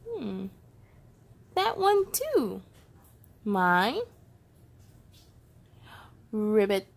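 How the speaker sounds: lively picture-book read-aloud with voiced characters; reading to children. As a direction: bring each rainy-day page to life for little listeners.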